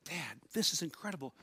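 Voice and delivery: put-on very soft, amazed little-boy voice; imitated hushed awe. Young son to dad after the talk, hushed awe. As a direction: drop right down, confiding in wonder.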